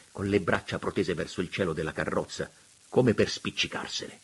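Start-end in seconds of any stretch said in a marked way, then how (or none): none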